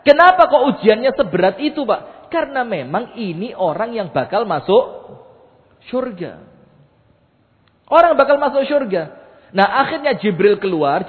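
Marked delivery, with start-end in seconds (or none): 0.0-2.3 raised question. Real, not acted. Rhetorical question to congregation. 7.9-9.2 emphatic declaration. Real, not acted. Emphatic repeat.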